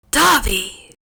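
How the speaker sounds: loud sharp cry of a name; shriek. Acted.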